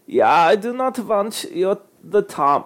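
a hint of a put-on French accent, slow; lightly voiced French accent; mock French. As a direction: drawl it like a Frenchman. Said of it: "like, French with that".

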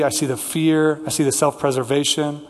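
ordinary delivery

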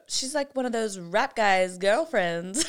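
put-on Valley girl drawl; lightly put-on Valley girl accent. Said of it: "[name] is talking like a Valley girl".

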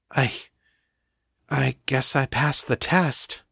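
halting, unsure start; Danny hesitant after the kiss. A narrator's reading. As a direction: still shaken.

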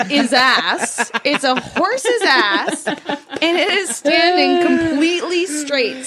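real giggles breaking into the words; cracking up. Real, not acted. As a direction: deliver the punchline through laughter. Cracking up at the reveal.

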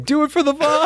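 high-pitched mock cry; imitating punched guy. Player mimics the guy getting punched in the recorded video, high mock yell. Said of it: "the guy getting punched, going".

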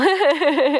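short high laugh; real laugh. Real, not acted. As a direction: laugh at the absurdity. Laughing at own joke.

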